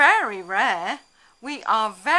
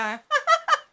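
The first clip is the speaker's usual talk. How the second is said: mock crowd laugh; 3 dB louder than usual. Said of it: "the rest of the bus usually go".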